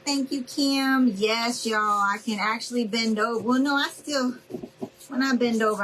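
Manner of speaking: ordinary delivery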